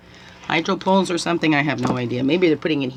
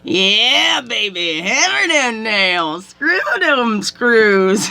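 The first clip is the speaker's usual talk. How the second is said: high, loud and slow teasing holler; a passenger catcalling builders, high, loud and drawn out; mock catcall; 7 semitones higher, 5 dB louder, much slower than usual. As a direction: holler it at workers from a car. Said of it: "I was like".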